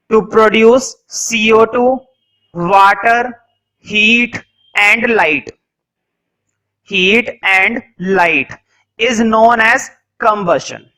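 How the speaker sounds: very slow, word by word with long pauses; slow dictation. Shown as a demonstration. Teacher dictating a definition for notes, very slow. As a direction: dictate each word so students can write. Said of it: "dictation continues".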